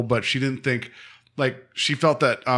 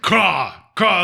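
mock bird call; bird caw; 5 semitones higher than usual. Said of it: "sounded like the bird".

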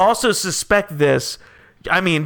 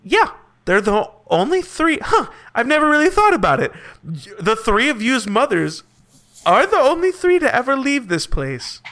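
higher voice, as if it just dawned on him; puzzled townsperson; 7 semitones higher than usual. Acted. Townsperson answers the travelers' question, innocently puzzled. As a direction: wonder aloud, lifting the pitch.

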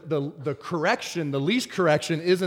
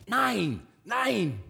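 higher-pitched, repeated scolding order; mock German dog command; 7 semitones higher than usual. Handler refusing the jumping dog in German, mock command. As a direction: imitate a hapless handler saying no.

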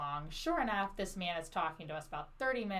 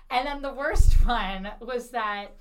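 ordinary talk

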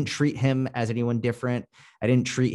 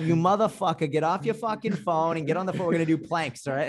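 higher-pitched, hammed-up Boston talk; Boston accent; 7 semitones higher than usual. Coach showing how he orders his client around, Boston accent. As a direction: play up the Boston accent, riding high. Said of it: "how to do it".

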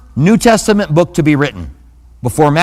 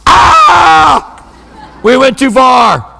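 put-on high, loud startled kids' cry; kids' alarm; 7 semitones higher, 5 dB louder, much slower than usual. Kids realizing they pushed dad too far, high yelp. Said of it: "me and my sister would go".

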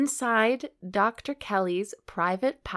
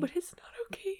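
whisper; 10 semitones higher, 10 dB quieter, much faster than usual. Pretend. Whispered back and forth.

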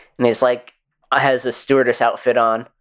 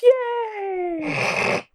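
mock cheer; 4 dB quieter than usual. Mock celebration of short men.

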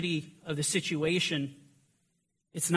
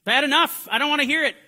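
loud, high mocking outburst of someone refusing to listen; hammed-up "I'm done" yell, high and loud; mock exasperated shout; 9 semitones higher, 11 dB louder, faster than usual. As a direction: shout it like a man slamming the door on the conversation. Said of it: "He's like".

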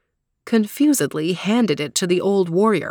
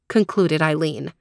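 plain reading voice

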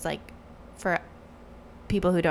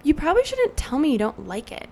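raised, louder mock telling-off; indignant; 6 semitones higher, 5 dB louder than usual. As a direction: set her straight, pitched up. Retold indignant reply to technician.

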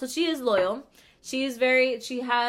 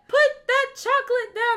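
hammed-up bickering grandma; cranky old lady; 9 dB louder than usual. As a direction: scold like an old woman at dinner. Imitating elderly friend nagging.

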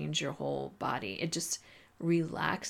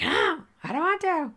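high, loud mock whine of refusal; defiant student; 11 semitones higher, 6 dB louder than usual. Student refusing the teacher's advice, defiant high-pitched protest. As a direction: act out a student's protest, much higher and louder. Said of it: "they're like".

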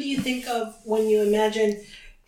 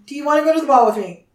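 fast; 3 semitones higher, 7 dB louder, much faster than usual. Retold or imitated. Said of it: "And she's like".

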